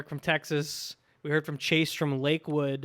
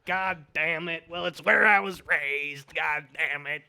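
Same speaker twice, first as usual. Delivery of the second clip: put-on South Carolina accent. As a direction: drag it out in mock Southern. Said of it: "doing my South Carolina accent".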